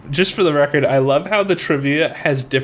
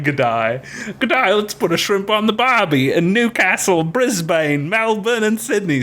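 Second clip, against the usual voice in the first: Australian accent; 7 semitones higher than usual. Shown as a demonstration. Contestant proving the trick works, broad Australian accent. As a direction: launch gleefully into broad Australian. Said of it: "you nailed it that time".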